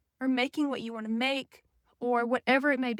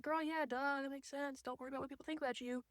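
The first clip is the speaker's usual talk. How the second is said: much softer, quick offhand brain voice; tossed-off murmur of obvious advice; soft quick duh; brain's quiet voice; 14 dB quieter than usual. Retold or imitated. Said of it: "my brain's like".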